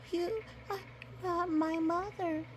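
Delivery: high, soft, frightened cub voice; scared cub. Acted. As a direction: cower and whimper the words.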